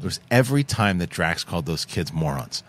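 ordinary delivery

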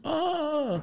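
put-on high yelp of a falling old man; a short startled high cry; mock old man cry.

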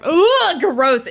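loud, high disgusted ugh; annoyed ugh. Real, not acted. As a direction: recoil in disgust. Disgusted at swollen mouse.

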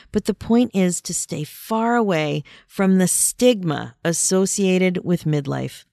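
normal talking voice

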